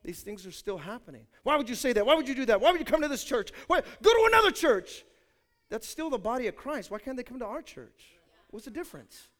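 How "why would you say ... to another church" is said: louder, higher mock outrage, climbing to a shout; hammed-up churchgoer scolding a newcomer; judgmental indignation. Judgmental member chasing a sinner out, mock outraged shout.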